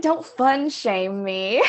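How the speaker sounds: slowly drawn-out mock protest; a slow, half-joking fun-shame protest; slow mock plea. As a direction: stretch it out slowly, mock offended. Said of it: "He was like".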